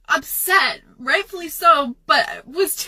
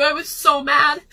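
normal talking voice

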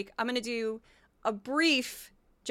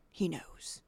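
whisper; 6 semitones lower, 9 dB quieter than usual. Retold or imitated. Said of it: "and a brief Kari Lake".

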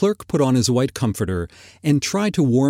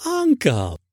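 high, pleading nephew; high, falling appeal; 9 semitones higher than usual. A narrator's reading. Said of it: "pleaded the nephew".

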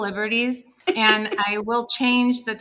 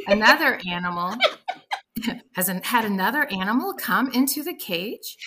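usual speaking voice

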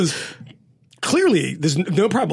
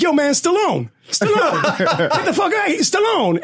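high mock jeer; retold heckling; 8 semitones higher than usual. Inmate razzes the Stallone type, mock jeering. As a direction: imitate a guy razzing someone, pitched up.

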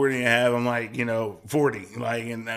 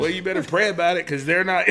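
laughing retort; 6 semitones higher, 4 dB louder than usual. Retold or imitated. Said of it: "I was like".

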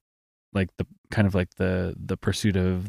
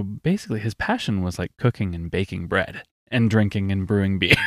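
ordinary delivery

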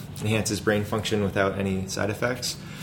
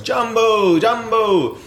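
high, loud drawn-out greeting; exuberant greeting call; 13 semitones higher, 7 dB louder, much slower than usual. Retold or imitated. Founder imitating everyone's greeting in Africa, exuberant call. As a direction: call it out to everyone like a jubilant hello. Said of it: "where everyone's".

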